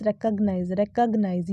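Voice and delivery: recognize with re as in red; correct recognize. Shown as a demonstration. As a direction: say recognize as rek-og-nize. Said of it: "correct pronunciation". (recognize: रे से शुरू, रेकग्नाइज़)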